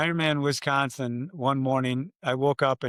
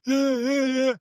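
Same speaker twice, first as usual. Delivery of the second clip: imitated crowd roar; 10 semitones higher, slower than usual. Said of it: "a roar came up from the water".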